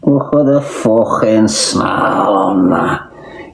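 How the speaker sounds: drawn out very slowly, savouring each word; slowly savoured brag about the snarl; slow, dragged-out boast. Shown as a demonstration. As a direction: stretch it out like a proud showoff. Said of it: "Do you wanna see me snarl?".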